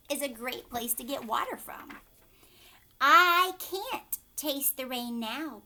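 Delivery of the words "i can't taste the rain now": slow, loud lion voice with a hint of purr; lion's purr. Lion tells the animals he can't taste the rain, slow and loud. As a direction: let the lion savour each word. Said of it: "purred the lion".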